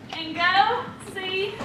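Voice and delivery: ordinary talk, nothing marked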